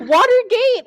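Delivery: high, loud mock-incredulous question; incredulous exclamation. Host replaying her reaction to co-host, incredulous. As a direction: shoot the voice up in hammed-up disbelief.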